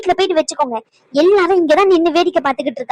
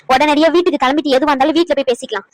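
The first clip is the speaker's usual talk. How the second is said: ordinary talk, nothing marked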